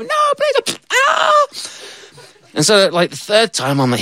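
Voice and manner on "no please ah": very high crying shriek; worm's squeaky scream. Pretend. Worm being hooked, tiny crying shriek.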